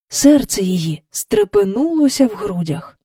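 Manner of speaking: plain reading voice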